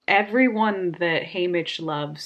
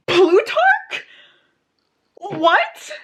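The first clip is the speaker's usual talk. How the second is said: much higher and louder, incredulous; shocked cry; 11 semitones higher, 7 dB louder, much slower than usual. Real, not acted. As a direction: yelp the names in disbelief. Shocked reading twist.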